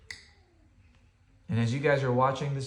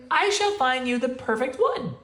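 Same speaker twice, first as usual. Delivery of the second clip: ordinary delivery, nothing marked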